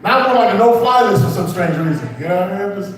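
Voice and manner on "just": usual speaking voice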